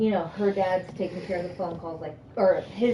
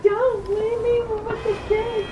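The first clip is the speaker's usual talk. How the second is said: much higher, slow sobbing plea; drunken crying plea; 14 semitones higher, 4 dB louder than usual. Retold or imitated. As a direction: beg him tearfully not to go. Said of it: "I'm like".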